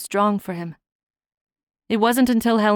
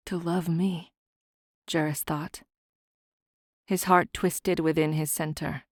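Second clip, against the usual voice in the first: ordinary delivery, nothing marked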